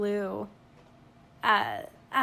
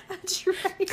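laughing; 8 semitones higher than usual. Real, not acted. Laughing echo of partner's joke.

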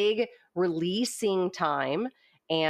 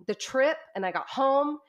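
normal talking voice

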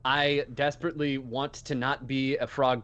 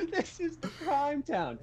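mock echo; 11 semitones higher, 5 dB quieter, slower than usual. Player echoes mockingly.

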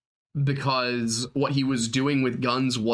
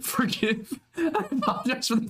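reading the apology through laughter, higher; reading a viewer's apology while laughing; 10 semitones higher than usual. Acted. Giggling through the viewer's apology. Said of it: "I entered into an odd state".

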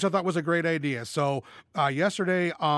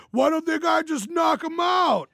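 mock drunk bar fan, shouted much higher and louder; drunk casual; 12 semitones higher, 4 dB louder than usual. Mocking drunk sports-bar casuals yelling at the TV, hammed-up shout. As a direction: yell it like a know-it-all drunk at the bar. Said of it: "drunk casuals spouting off their genius hot takes".

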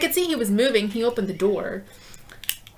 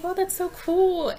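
put-on impressed kid; 10 semitones higher, slower than usual. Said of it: "And Tommy's like".

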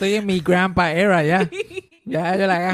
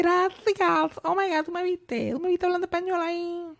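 high, gushing mock American lady; excited gringa customer; 13 semitones higher, 3 dB quieter than usual. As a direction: gush like a thrilled tourist showing off her Spanish.

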